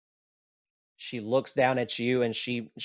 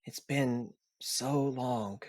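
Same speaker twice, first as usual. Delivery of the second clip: a dying son whispering to his father; weak whisper; 7 dB quieter than usual. Acted. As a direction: let the last breath carry the words. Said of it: "He takes a very long, deep breath".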